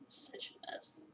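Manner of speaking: slow whispered aside. Acted. Player muttering to himself about the mess, whispered. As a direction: breathe it out to yourself.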